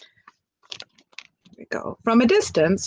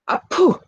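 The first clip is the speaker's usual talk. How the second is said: sneeze; 9 semitones higher than usual. Acted. Great fish sneezes the snake away, sudden sneeze. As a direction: blast it out like a sneeze.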